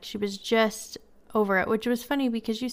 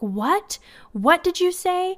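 put-on much higher and louder, slow, a kid in outraged disbelief; shocked disbelief; 10 semitones higher, 5 dB louder, slower than usual. Little nephew hearing his niece join the dark side, shocked. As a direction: gasp it out like a boy who can't believe his ears.

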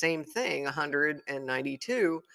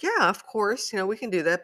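eager yes; 6 semitones higher, 4 dB louder than usual. Retold or imitated. Retold eager agreement.

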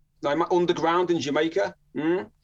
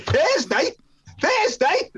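high, loud incredulous echo; shocked disbelief; 14 semitones higher, 4 dB louder, much slower than usual. Retold or imitated. Teller re-enacts his shock at waiting till Thursday, incredulous. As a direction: squawk it back in mock disbelief.

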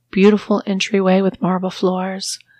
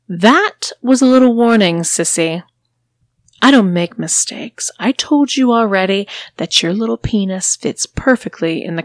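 louder, higher cold warning; cold mistress; 4 semitones higher, 4 dB louder than usual. Acted. Mistress warns her sissy after the shock, cold and taunting.

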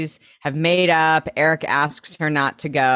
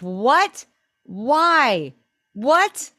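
high, rising incredulous questions; mock disbelief; 8 semitones higher, much slower than usual. Host reacting to the breakup excuse, high incredulous yelps. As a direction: let each word swoop up in bafflement.

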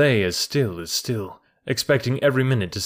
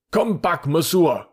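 high, commanding mob call; raised, high-pitched villagers' order; crowd shouting; 7 semitones higher, slower than usual. A narrator's reading. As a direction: shout her back. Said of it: "shouted the crowd".

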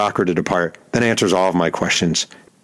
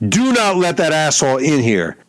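retold scream; 8 semitones higher than usual. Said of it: "screaming at the clubhouse attendant".

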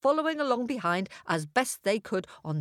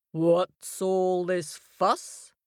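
slow, weighty lion voice; majestic lion; much slower than usual. A narrator's reading. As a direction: ask with calm authority. Lion questions the animals.

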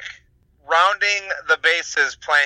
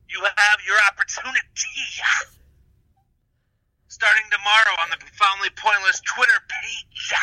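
wrestling promo; 5 semitones higher than usual. Acted. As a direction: cut a promo like a pro wrestler. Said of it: "Cut it like a wrestler promo".

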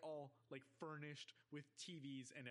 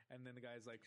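ordinary talk, nothing marked

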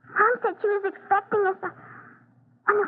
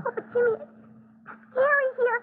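frightened pleading; 5 semitones higher than usual. Acted. Sister begging her brother to leave, near tears and afraid. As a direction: whimper that it's scary.

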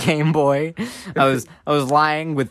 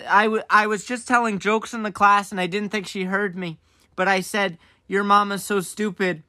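lightly acted kid, higher pitch; a hint of pleading child; confessing to mom; 6 semitones higher than usual. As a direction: own up in a raised, explaining voice.

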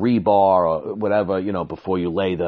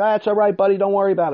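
much higher, louder breezy reassurance; forced cheer; 13 semitones higher, 5 dB louder, much faster than usual. Retold or imitated. To the worker who spilled the wheelbarrow, high forced-cheery reassurance. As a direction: cover the frustration with cheer. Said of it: "I'm not yelling at anybody".

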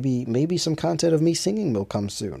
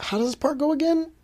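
high-pitched, mock-puzzled question; puzzled self-quote. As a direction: act out drawing a blank, voice way up. Acts out forgetting his own song.

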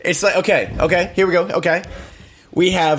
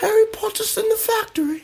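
"factory" in a much higher, lightly British voice; playful mock British line about Harry Potter; mock British accent; 3 dB quieter than usual. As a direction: chirp it like a schoolboy wizard.

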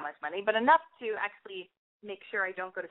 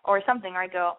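ordinary talk

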